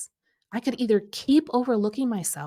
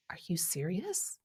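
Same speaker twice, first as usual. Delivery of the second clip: hushed, whispered disbelief; incredulous whisper; 10 dB quieter than usual. Retold or imitated. Overlooked coach reacting to a friend's post, incredulous whisper. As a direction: whisper it as if you can't believe it. Her stunned reaction, retold.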